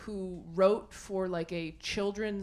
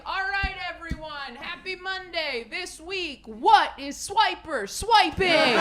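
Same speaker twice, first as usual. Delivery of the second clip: higher, hammed-up meeting leader, slowing on the last line; mock writers-room boss; 9 semitones higher, 7 dB louder than usual. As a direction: pitch it like a chipper boss opening a meeting. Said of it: "a conference room full of people like".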